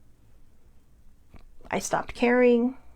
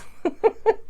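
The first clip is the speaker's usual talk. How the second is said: light laugh; 13 semitones higher than usual. Real, not acted. Laughs at own phrase.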